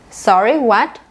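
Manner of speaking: "Sorry, what" with upward swings; demonstrated questioning rise through "Sorry, what"; rising intonation. Shown as a demonstration. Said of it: "the rising intonation".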